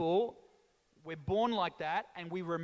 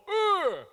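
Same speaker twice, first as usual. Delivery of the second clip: high, loud angry grunt; dad's grunt; 11 semitones higher, 8 dB louder than usual. Retold or imitated. Italian dad grunts awake his sleeping son. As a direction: blast a wordless grunt. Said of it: "He opened the door".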